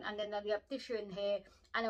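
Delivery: muffled through fingers; speaking behind hand; hand over mouth. Real, not acted. Seen 2: Hand covers mouth until 1.8s, then hands move to cheeks.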